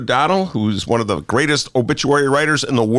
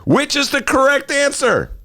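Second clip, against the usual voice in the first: ordinary talk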